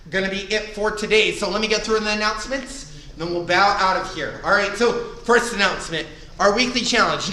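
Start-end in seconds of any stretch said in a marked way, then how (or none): none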